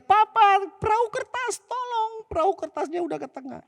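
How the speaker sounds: put-on high child's yell for help; child calling for help. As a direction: shout across the lake for dad. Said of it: "Terus dia teriak".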